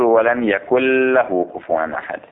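"يكن له" said as يكلّه, nun merged into the lam; slow, careful recitation of "ولم يكن له". Shown as a demonstration. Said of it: "فتقول". (إدغام النون في اللام: "يكلّه")

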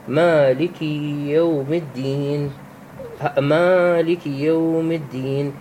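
slow chanted مالك يوم الدين with the alif of مالك held; slow tajweed chant; Quranic recitation. Shown as a demonstration. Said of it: "مثل قوله تعالى في سورة الفاتحة".

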